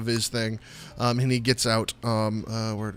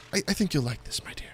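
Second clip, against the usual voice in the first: soft higher halfling voice; Stefan's voice; 4 semitones higher, 8 dB quieter, faster than usual. Acted. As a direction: offer it gently. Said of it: "and he says".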